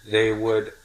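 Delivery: usual speaking voice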